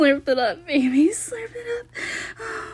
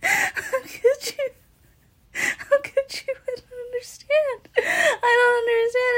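much higher, slow, crying voice; tearful pleading; 11 semitones higher than usual. Acted. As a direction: plead through tears, voice breaking. Partner leaving, she breaks down.

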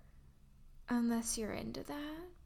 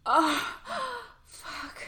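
loud moan; 6 dB louder than usual. Acted. Aroused gasp during intimacy.

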